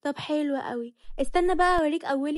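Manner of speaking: rising to a loud, high threat; defiant threat. Acted. Wife threatening in quarrel. (تهديد بصوت عالي وعنيد)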